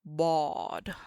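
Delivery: "bawd" with a heavy, dark emphatic D; dramatic D. Shown as a demonstration. Teacher showing her favorite letter, loud. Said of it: "other dramatic D".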